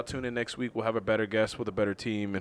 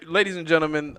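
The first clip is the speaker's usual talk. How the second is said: ordinary delivery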